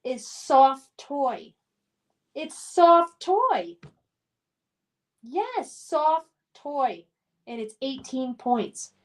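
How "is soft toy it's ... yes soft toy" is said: deliberate quiz-answer reveal; slow announcement. Real, not acted. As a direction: spell out the answer slowly. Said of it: "Number two".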